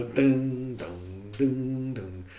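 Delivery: voiced guitar beat, dun dun; guitar strums imitated. Shown as a demonstration. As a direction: strum the tempo with your voice. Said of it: "About a tempo like that".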